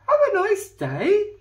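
high, loud chirping little bird; chirpy bird. Acted. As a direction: chirp it brightly like a chaffinch. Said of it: "it chirped".

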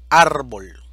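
árbol dicho fuerte, golpe en ÁR; ejemplo enfatizado. Shown as a demonstration. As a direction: marca fuerte la sílaba ÁR de árbol. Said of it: "llanas o graves con tilde". (árbol, con fuerza en ÁR)

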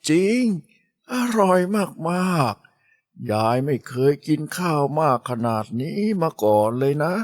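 put-on slow, high, delighted old woman; grandma praising the girl's cooking, delighted. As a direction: gush over the food like a pleased granny. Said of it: "ออกปากชมนิดอย่างไม่หยุดปาก".

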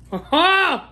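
short bright burst of laughter; high delighted laugh. Real, not acted. Laugh at the food.

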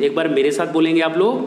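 ordinary delivery

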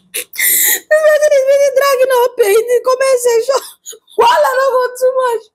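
high, wailing through laughter; laughing while talking. Real, not acted. Vlogger cracking up at a dragged comment section, laughing. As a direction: squeal the words out, cracking up.

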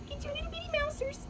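tiny high squeaky mouse voice; mousy voice. Pretend. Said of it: "Let's try it with a mousy voice".

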